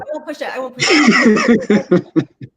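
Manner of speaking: breaking into loud laughter; laughing. Real, not acted. Answering a warning not to push it, laughing. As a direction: promise through a growing laugh.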